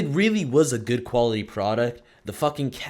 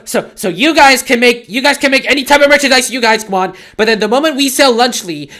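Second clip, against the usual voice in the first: high, very loud outraged screaming imitation; hammed-up high-pitched shouted tantrum; mock screaming; 12 semitones higher, 13 dB louder than usual. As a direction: mimic panicked YouTubers screaming in self-defence. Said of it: "They started fucking screaming. They're like".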